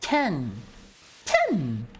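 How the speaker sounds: "Ten" said alone, hard; emphatic "Ten", repeated for practice; stressed first syllable. Shown as a demonstration. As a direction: punch out "Ten" as one isolated syllable. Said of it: "Emphasis on the first syllable".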